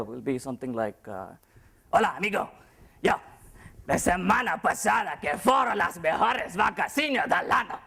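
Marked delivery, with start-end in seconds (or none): 1.9-7.8 put-on Spanish accent. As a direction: belt it out like a booming Spanish boss. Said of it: "fear for my Spanish boss".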